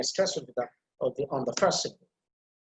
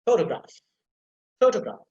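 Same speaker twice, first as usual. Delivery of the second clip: Photograph with strong PHO, weak schwa in the middle; careful stress demo; 4 dB louder than usual. Shown as a demonstration. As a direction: punch PHO in Photograph, barely sound the second vowel. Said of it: "listen to me carefully the way I can pronounce".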